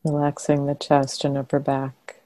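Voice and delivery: calm meditation guidance with long pauses between phrases; guided meditation. Real, not acted. Teacher guiding meditators to soften and relax the body, guided meditation. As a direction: guide them gently into relaxation, leaving long silences.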